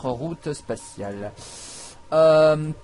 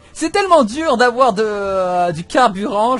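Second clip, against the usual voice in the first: exasperated complaint; 6 semitones higher, 8 dB louder than usual. Real, not acted. Vents about scarce fuel.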